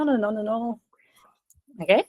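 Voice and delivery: normal talking voice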